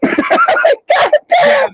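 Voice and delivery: high shouted lament; despairing cry. Retold or imitated. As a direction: wail it in disbelief. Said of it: "I'm like".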